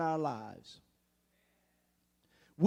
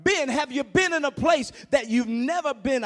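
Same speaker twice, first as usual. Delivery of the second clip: loud, rising sermon; preaching at full force; 5 semitones higher, 6 dB louder than usual. Acted. Preacher rouses congregation, shouting with rising fervor. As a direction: drive it home to the congregation.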